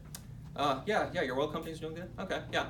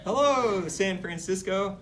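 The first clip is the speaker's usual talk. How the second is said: loud, slow rally greeting to the crowd; mock presidential greeting; 6 semitones higher, 5 dB louder, slower than usual. President greeting the San Francisco crowd, loud and slow. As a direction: hail the whole city like a campaigner.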